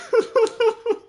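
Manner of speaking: high squeaky laugh; giggling fit; high giggle. Real, not acted. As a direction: burst of giggles.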